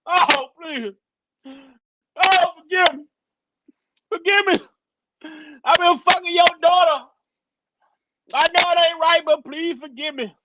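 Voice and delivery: begging forgiveness. Retold or imitated. Man on his knees begs parents, hammed-up pleading. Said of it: "he gonna get on his knees and say".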